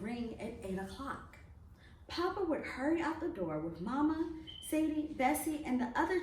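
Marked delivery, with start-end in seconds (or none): none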